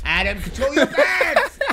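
high, slow cry through laughter; laughing scolding. Real, not acted. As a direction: call him to order, cracking up.

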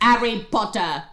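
hammed-up British accent on the name; bad British accent. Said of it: "my sister's bad British accent".

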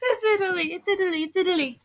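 high-pitched excited squeal. Real, not acted. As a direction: squeal with delight at seeing Italy. Spotting a favourite character appear in game.

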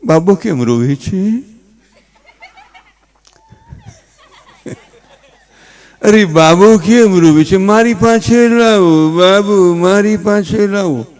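mother coaxing slowly, with rising questions; fussing mother. Retold or imitated. Mother asks why the baby cries, slow coaxing. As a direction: draw out each anxious question to the baby, slowly. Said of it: "ઓલી છોકરાની mummy".

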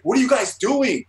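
high, loud imitation of a startled bystander; alarmed outcry. Comics creator interrupting a staged fight, alarmed shout. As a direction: call out in shocked disbelief. Creator confronts the screaming pair.

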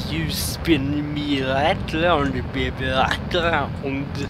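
put-on slow sung line in a joke Indian call-centre accent; tech support scammer accent. Gamer jokingly singing to a spinning rocket, slow sung in a scammer accent. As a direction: croon it like a scam caller from a call centre, slow. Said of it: "like a tech support scammer kind of accent".

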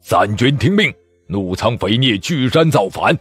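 a general shouting orders to the imperial troops; commanding shout. Acted. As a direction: order the armies to fight. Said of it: "举臂高呼".

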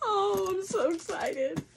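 excited. Real, not acted.

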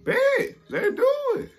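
high and loud, all in; jumping at the idea, high-pitched; hyped agreement. Retold or imitated. Eager yes to a plan.

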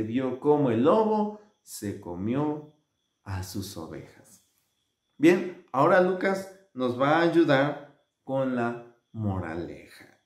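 ordinary delivery

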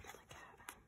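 breathy hushed whisper. Real, not acted. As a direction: whisper it in awe. Awed whisper.

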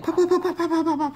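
high, rapid-fire firecracker pops; firecracker popping. Retold or imitated. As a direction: imitate a string of firecrackers going off. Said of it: "make that loud noise at the very end".

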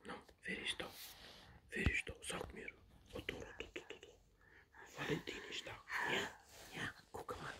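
whispered peekaboo; soft hushed wer ist da. Real, not acted. Grandparent whispering to baby.

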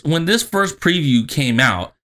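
ordinary talk, nothing marked

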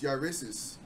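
ordinary talk, nothing marked

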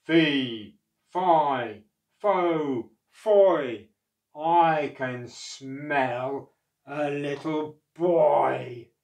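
loud, very slow booming chant; giant's shout. Acted. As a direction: boom it out slowly like a giant. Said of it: "shouted the giant".

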